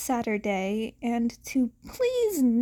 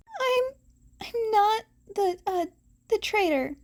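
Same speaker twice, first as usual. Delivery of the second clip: nervous mumble; 9 semitones higher than usual. A narrator's reading. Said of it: "Izuku mumbled nervously".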